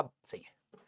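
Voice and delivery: whispered to himself, pleased; a sly little whisper. Retold or imitated. बच्चा मिट्टी के लड्डू देखकर संतुष्ट. (फुसफुसाहट में, खुश होकर)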